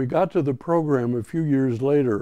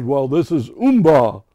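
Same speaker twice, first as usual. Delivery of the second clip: gorilla sign-off; 7 dB louder than usual. Retold or imitated. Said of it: "he says".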